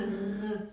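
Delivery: drawn-out r gargled in the throat; gargling sound. Shown as a demonstration. Teacher introducing the letter, gargled throaty r. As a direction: gargle a long French-style r. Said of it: "the gargling sound".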